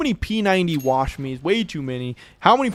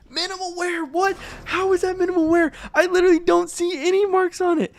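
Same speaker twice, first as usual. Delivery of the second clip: pitched up high in real disbelief; can't believe the grade he got; shocked disbelief; 12 semitones higher than usual. Real, not acted. Streamer sees minimal wear on a clean skin, high disbelief.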